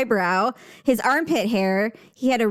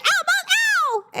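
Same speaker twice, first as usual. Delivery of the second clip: put-on much higher child voice crying ow; child's ow; much slower than usual. Mom imitating her daughter yelping at the shot. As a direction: squeal it like a pinched little girl. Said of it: "And she's like".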